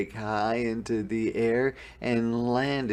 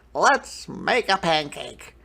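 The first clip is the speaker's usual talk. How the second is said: put-on eager boy; 6 semitones higher, 4 dB louder than usual. A narrator's reading. Jack voiced in story.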